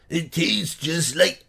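slow, put-on Japanese accent that misses; a badly faked Japanese accent, slowly; failed Japanese accent. Vlogger mocking a Japanese candy, clumsy Japanese accent. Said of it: "I can't even do a Japanese accent".

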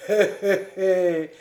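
a knowing little "hé, hé, hé" laugh; sly chuckle. A narrator's reading. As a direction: chuckle slyly at the joke. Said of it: "fit Hahn".

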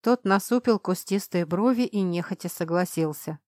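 ordinary delivery

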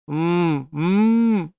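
muffled moan through taped mouth; desperate muffled moan. A narrator's reading. Gagged Brace moaning to Jane, desperate muffled moan. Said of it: "отчаянно стонал Брейс".

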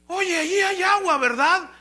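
high, loud, eager wolf calling out. Acted. Wolf asks the deer in the well about water, eagerly. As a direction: pipe up keenly as a thirsty wolf.

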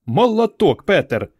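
delighted praise. A narrator's reading. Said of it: "чмокнула його в щоку Варка".

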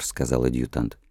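usual speaking voice

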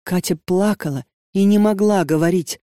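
usual speaking voice

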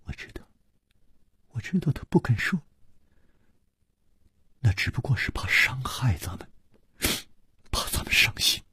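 sorrowful, breathy near-whisper; downcast. A narrator's reading. Su Rongrong defends him through tears, downcast and hushed. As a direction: grieve softly, voice nearly gone. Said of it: "黯然说".

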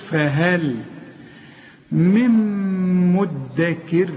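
slow فهل, hummed م into مدكر; model letter articulation. Shown as a demonstration. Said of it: "اتعلم من القرآن الحروف".

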